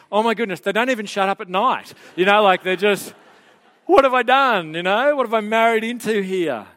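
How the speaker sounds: a hammed-up newlywed groaning in dismay; wife's dismay. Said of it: "she's lying there going".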